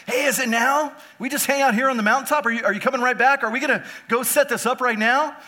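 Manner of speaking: eager disciples. Retold or imitated. Disciples pestering Jesus on the mountain, eager and loud. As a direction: bounce with impatience. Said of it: "the disciples are like".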